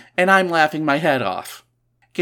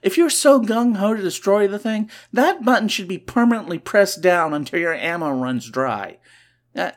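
usual speaking voice

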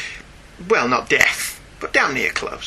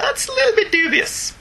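mock doubt. Imagined reaction to trunks.

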